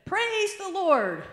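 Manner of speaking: slow, high, loud drawn-out praise; preacher's call. Retold or imitated. Preacher calling to his horse, slow and loud. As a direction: stretch it out to get the horse going.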